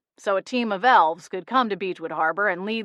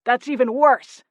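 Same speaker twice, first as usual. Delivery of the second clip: outraged; 5 semitones higher, 6 dB louder than usual. A narrator's reading. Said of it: "the outraged gnome".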